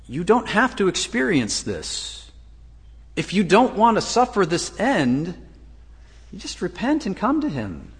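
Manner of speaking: urgent, high-pitched appeal voicing Jesus; Jesus pleading with listeners. Preacher voices Jesus offering a way out of judgment, high-pitched plea. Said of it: "but he's saying".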